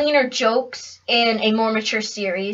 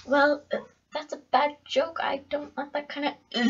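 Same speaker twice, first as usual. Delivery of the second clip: mock disapproval; 2 semitones higher, 11 dB quieter than usual. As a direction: imitate a meek complaint under the breath. Mimics an easily offended viewer.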